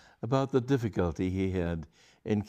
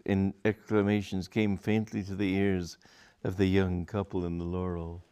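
ordinary delivery

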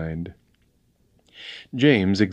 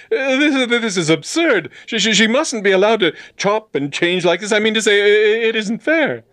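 high, loud, stammering protest; flustered, squeaky-high young man objecting; agitated outcry; 5 dB louder than usual. A narrator's reading. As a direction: splutter indignantly, tripping over words. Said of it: "cried James".

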